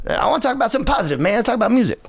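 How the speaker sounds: fast, high, raspy soul-singer voice. Retold or imitated. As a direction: dodge the question like a showman.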